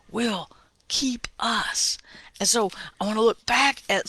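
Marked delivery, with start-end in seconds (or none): 0.1-2.0 slow whisper; slowly whispered will keep us; hushed promise. Real, not acted. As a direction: let it fall to a whisper. Sermon ending on a hushed promise.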